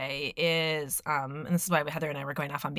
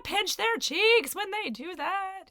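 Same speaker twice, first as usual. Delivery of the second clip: cooing; 14 semitones higher than usual. Real, not acted. Cooing over cute kid.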